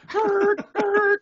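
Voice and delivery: high, loud honks; imitated car horn. Drivers honking at the one who stopped, loud imitated horn.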